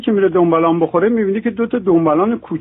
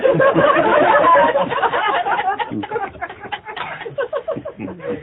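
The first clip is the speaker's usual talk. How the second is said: long high laugh; 4 dB quieter than usual. Real, not acted. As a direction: crack up at the joke. Laughter after joke.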